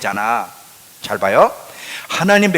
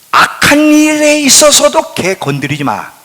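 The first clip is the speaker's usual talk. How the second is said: a hint of God warning sternly, pitched higher; God's warning voice; 11 semitones higher, 6 dB louder than usual. Preacher voicing God warning Laban, high and firm. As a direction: lightly voice God's stern command. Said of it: "하나님 뭐라 그러냐면".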